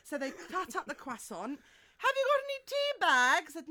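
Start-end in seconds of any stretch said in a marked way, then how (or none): 2.0-3.5 high, loud posh lady voice; hammed-up posh landlady asking. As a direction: chirp it like a fussing posh landlady.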